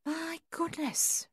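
slow, high, hushed my goodness; amazed. A narrator's reading. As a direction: let it out in soft amazement. Judy amazed at the visitors.

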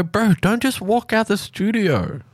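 high pleading complaint; high-pitched plea. Real, not acted. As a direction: whine it up high at him. Co-host walks out again.

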